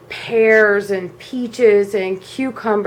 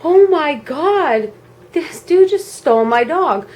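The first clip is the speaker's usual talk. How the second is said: higher, drawn-out disbelieving thought; retold horror, stretching the first words; dawning alarm, acted out; shocked realization; 6 semitones higher, 4 dB louder than usual.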